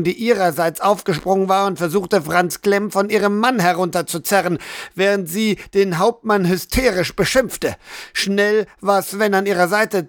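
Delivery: plain reading voice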